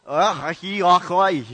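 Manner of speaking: garbled, throaty mock Scottish; mock Scottish accent. Preacher teasing Scots for being hard to follow, mock Scottish accent. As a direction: imitate an unintelligible Scottish accent. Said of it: "can't even hear what they're saying".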